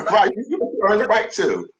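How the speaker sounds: retelling advice through laughter; laughing quote. Retold or imitated. Quoting a friend's advice about judging, laughing. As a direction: laugh while quoting her. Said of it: "You was like".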